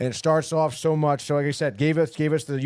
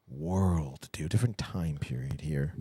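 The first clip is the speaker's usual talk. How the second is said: whispered aside; 11 semitones lower, 7 dB quieter, slower than usual. Real, not acted. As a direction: drop to a whisper. Drops to a whisper.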